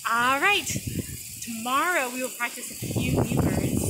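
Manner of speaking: ordinary delivery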